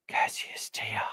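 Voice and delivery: breathy close-mic whisper of the name; ASMR whisper. Shown as a demonstration. Podcaster whispering a player's name ASMR-style. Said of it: "A S M R?".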